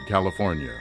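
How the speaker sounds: normal talking voice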